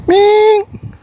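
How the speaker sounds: high, loud call across the yard. Real, not acted. Woman calls her cat Ming from afar, loud high call. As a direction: call the cat's name out loud.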